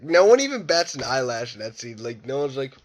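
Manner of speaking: ordinary talk, nothing marked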